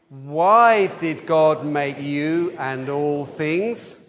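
slow, spaced call for the group to answer; slow recitation. Shown as a demonstration. Leader asking the questions with the adults, slow and deliberate. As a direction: call out each question slowly for the children. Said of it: "all the adults this time can ask the question".

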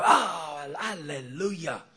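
mock-joyful hallelujah from a happy churchgoer; cheerful amen-corner voice; joyful Pentecostal. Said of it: "they go".

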